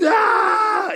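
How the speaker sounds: high, held "Nah" shout; drawn-out mock yell; mock outcry. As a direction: cry it out long and high in playful protest. Mock buzzer reply to audience answer.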